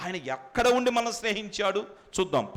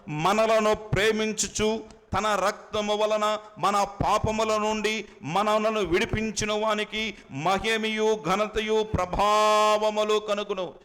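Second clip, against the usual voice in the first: slow, raised reading of a Bible verse; scripture reading; 6 semitones higher, 3 dB louder, slower than usual. Acted. Preacher reads scripture slowly and loudly to congregation. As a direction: read the verse out slowly to the church.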